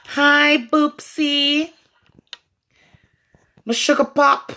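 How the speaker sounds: cutesy greeting. Pretend. Sweet-talking greeting.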